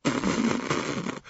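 muffled giggle under a coat; stifled laugh. A narrator's reading. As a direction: laugh hidden behind a coat. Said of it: "him laughing".

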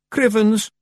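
high, loud little Scots Feegle. A narrator's reading. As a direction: pipe up like a guilty tiny pixie. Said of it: "said the voice from under the bed".

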